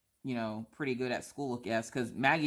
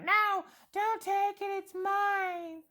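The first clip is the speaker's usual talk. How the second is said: put-on much higher, slow, whiny little girl; whiny child; much slower than usual. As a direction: clutch it like a dramatic kid. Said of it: "she's like".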